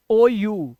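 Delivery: ordinary talk, nothing marked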